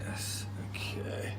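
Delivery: slow, breathy whisper to crew; whispered aside. Real, not acted. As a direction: keep it off mic, hushed.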